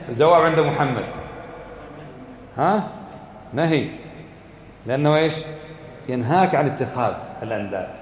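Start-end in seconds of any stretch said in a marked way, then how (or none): none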